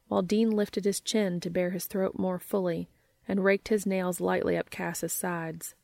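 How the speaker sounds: ordinary delivery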